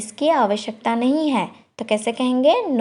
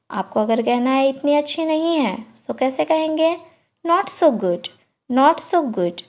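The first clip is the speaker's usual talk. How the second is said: usual speaking voice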